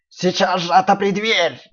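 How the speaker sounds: slow loud wolf growl. Acted. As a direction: growl the order slowly. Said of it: "прорычал волк".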